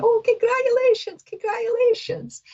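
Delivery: high-pitched mock gushing; excited congratulations. Retells congratulating someone on a pregnancy.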